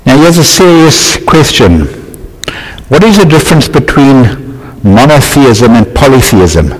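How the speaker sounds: ordinary talk, nothing marked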